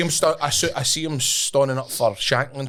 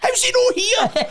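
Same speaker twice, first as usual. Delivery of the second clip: much higher, slow, laughing fake concern; mock concerned voice; 3 dB louder, slower than usual. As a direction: squeak it out through laughter. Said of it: "I'm going".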